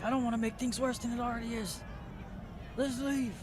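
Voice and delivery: high-pitched, uneasy voice from the crowd; worried crowd member. Acted. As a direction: play a townsperson anxious to back out. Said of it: "you hear it's like".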